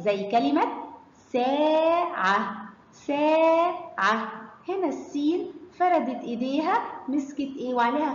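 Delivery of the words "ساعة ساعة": "ساعة" very slow, long held aa; drawn-out phonics demo of "ساعة"; long vowel. Shown as a demonstration. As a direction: stretch سا, then land on عة in "ساعة". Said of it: "خدنا الأصوات الطويلة".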